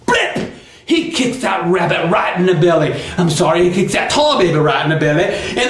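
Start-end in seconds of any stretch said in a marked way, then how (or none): 0.1-0.5 very high, popped blip; sudden impact noise. A narrator's reading. Storyteller imitates the kick landing, high sudden blip. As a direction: pop it out like a sudden hit.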